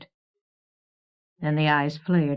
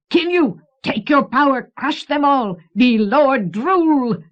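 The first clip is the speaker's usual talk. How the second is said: very high, loud crazed yell; creature shouting; 8 semitones higher, 6 dB louder than usual. A narrator's reading. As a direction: shout it as a power-mad creature, drawing out the end. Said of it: "the creature shouted".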